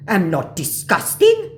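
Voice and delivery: slow, loud, high protest; offended mock scammer. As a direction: protest slowly, ending on a rise.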